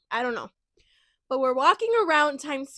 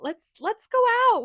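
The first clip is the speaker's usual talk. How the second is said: much higher, louder eager urging; excited suggestion; 11 semitones higher, 6 dB louder than usual. Retold or imitated. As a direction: pitch it up, egging her on. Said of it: "And we were like".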